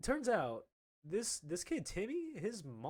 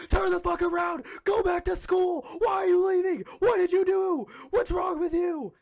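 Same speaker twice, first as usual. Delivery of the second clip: high, loud screamed tirade; full-volume, high-pitched mom meltdown; furious mom yelling; 12 dB louder than usual. Retold or imitated. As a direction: scream at your kid down the phone. Mom phones after he left school.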